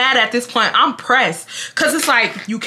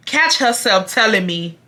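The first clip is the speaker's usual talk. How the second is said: ordinary delivery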